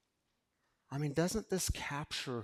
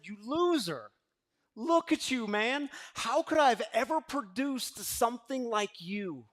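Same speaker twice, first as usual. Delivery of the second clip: mock angry father; 7 semitones higher, 5 dB louder than usual. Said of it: "he pointed at his son and said".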